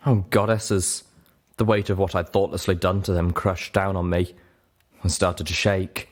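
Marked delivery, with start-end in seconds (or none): none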